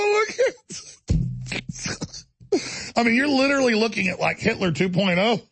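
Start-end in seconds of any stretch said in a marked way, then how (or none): 0.0-4.2 high, helpless laughter into speech; crying laughing. Real, not acted. Host cracking up at his own joke, crying laughing. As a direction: talk while recovering from a laughing fit. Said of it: "I'm sorry, I'm crying".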